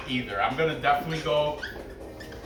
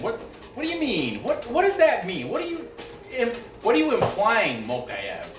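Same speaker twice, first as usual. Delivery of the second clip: incredulous, higher-pitched challenge; indignant questioning; 7 semitones higher than usual. Real, not acted. Host challenging a fighter over a gun post, indignant. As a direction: demand an explanation, pitch jumping up.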